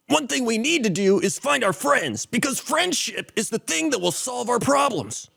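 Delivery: anime hero. Acted.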